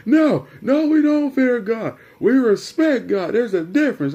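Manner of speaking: high, loud imitation of Dad protesting; indignant correcting dad. Dad correcting son about fearing God, indignant.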